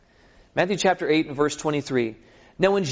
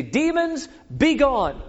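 pitched-up hammed command to demons; mock commanding; 9 semitones higher, slower than usual. Disciples commanding the demons, mock grand command. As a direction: bark it like a failed exorcist. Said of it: "they say".

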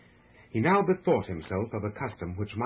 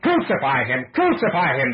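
loud, rising mob chant; fierce repeated demand; crowd cry; 4 semitones higher, 8 dB louder than usual. A narrator's reading. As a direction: shout it twice, higher the second time. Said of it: "they cried".

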